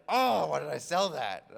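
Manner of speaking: loud, drawn-out mock wail; slow, booming show of regret; mock lament. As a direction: cry out in hammed-up seller's regret. Seller's mock lament, acted.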